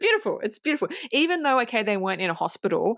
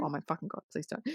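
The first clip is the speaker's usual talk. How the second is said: despairing mutter; 3 semitones lower, 12 dB quieter, much faster than usual. Real, not acted. Dreading musical requests.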